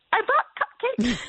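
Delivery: high, slow, timid voice; nervous squeak. Retold or imitated. Nervous neighbor offering cupcakes at the brothel door, timid and high. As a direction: squeak it out, shaking with nerves. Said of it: "she's so nervous".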